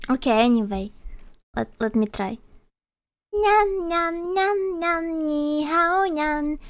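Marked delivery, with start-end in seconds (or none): 3.3-6.6 high-pitched cute anime voice; squeaky cute mascot voice; cute Paimon voice. Pretend. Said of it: "Make Paimon voice".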